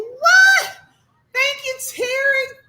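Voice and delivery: startled high cry of what; surprised. Real, not acted. Streamer shocked by Terry's super chat, startled cry. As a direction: genuine shock at the gift.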